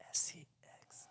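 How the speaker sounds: laughing whisper. Acted. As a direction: whisper it teasingly. Spelling out for ASMR fans.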